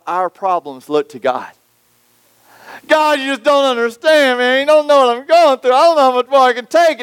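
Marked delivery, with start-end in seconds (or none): 2.9-7.0 high, pleading complaint to God; whining to God. Pretend. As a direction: beg God like the weight is too much.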